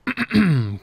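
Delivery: a short throat clear. Real, not acted. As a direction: clear your throat before going on. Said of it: "Clears throat".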